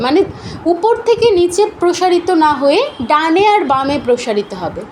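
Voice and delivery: ordinary talk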